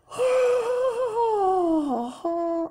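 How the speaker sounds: high, drawn-out yawning sigh; real yawn, long and high. Real, not acted. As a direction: let out a big open yawn. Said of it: "here come the yawns".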